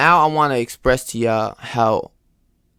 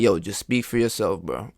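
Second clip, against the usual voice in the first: dismissive; 6 semitones lower, 7 dB quieter than usual. Retold or imitated. Friend dismissive retort.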